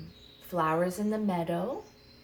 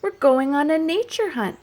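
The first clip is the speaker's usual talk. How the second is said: excited refrain; 9 semitones higher, 13 dB louder than usual. Acted. Chant refrain repeated bigger.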